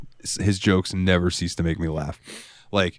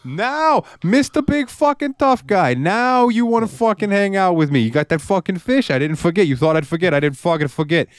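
high, hammed-up New York indignation; penguin with attitude; 11 semitones higher than usual. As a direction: taunt like an offended wise-guy penguin. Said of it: "that penguin would have a fucking attitude".

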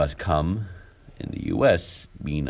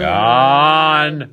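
shout; 9 semitones higher, 8 dB louder than usual. Pretend. Yelled name in skit.

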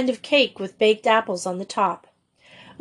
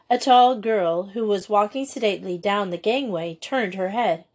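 ordinary delivery, nothing marked

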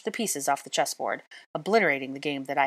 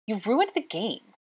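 accusing; 6 semitones higher than usual. A narrator's reading. Draco berates her over the game.

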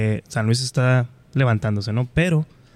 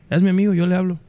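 confident friend voice; 6 semitones higher than usual. Retold or imitated. Retold friend's confident reply.

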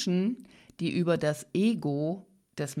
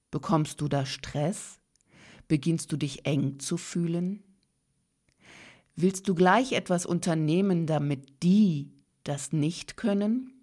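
ordinary talk

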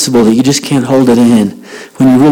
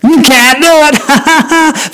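high, drawn-out glee breaking into laughter; gleeful teasing; 14 semitones higher, much slower than usual. Real, not acted. Speaker teases audience failing eyebrow test, gleeful. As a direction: crow it and laugh.

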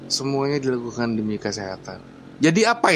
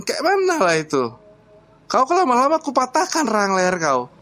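put-on higher, gruff-toned Batak accent threat; 10 semitones higher than usual. Batak father threatens the young man, Batak accent. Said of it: "Bapak ini sebenarnya orang mana? Madura atau Batak?".

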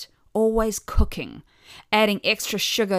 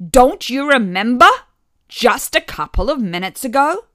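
bark; 5 semitones higher, 10 dB louder than usual. A narrator's reading. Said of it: "my mother barked".

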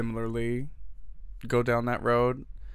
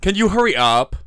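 high, loud snippy demand; catty; 9 semitones higher, 12 dB louder than usual. Retold or imitated. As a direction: whine it like a brat. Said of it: "Do I sound bitchy".

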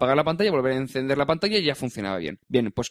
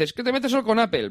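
imitated critics; 7 semitones higher than usual. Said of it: "gente dice".

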